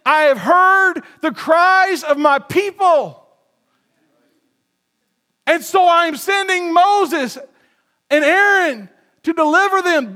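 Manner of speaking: loud, slow, high proclamation; booming divine declaration, slow; proclaiming God. Acted. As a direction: thunder it out as the voice of God. God's words proclaimed.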